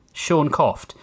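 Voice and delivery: ordinary delivery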